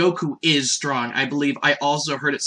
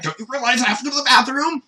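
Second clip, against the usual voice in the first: put-on high, fast, put-upon girl voice; rushed indignant Bulma voice; exasperated Bulma; 9 semitones higher, faster than usual. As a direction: snap it like she can't believe he asked. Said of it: "she's like".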